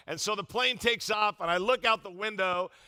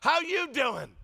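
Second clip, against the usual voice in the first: cheery greeting; 6 semitones higher, slower than usual. Retold or imitated. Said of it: "she's like".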